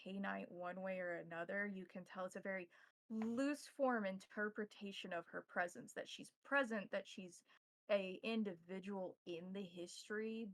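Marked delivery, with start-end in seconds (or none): none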